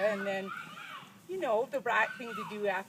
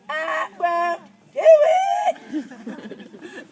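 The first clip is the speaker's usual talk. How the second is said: slow, much louder, dragged-out shouted refusal; defiant shout; 5 semitones higher, 13 dB louder, much slower than usual. Retold or imitated. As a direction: bellow the refusal, stretching each word. Child refuses father the final time.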